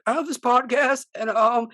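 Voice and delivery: put-on high, slow girlish retelling; mock your own nervous pitch, like a little girl. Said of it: "like a little freaking girl, dude, my voice".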